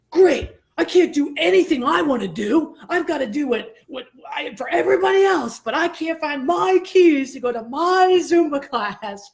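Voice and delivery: high-pitched mock tantrum; retold rage. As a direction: rant in exasperation, punching the stressed words. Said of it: "legitimate rage started to bubble up".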